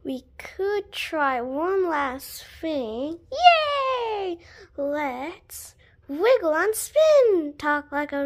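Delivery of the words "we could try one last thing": slow unvoiced whisper. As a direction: whisper it slowly like a secret. Whispered plan after pleading.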